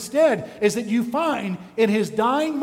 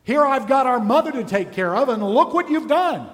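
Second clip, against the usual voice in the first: raised, higher-pitched accusing voice; reproachful brother; 4 semitones higher, 3 dB louder than usual. Acted. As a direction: lash out at your dying brother, louder and higher. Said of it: "if you're his brother".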